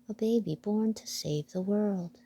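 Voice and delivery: ordinary delivery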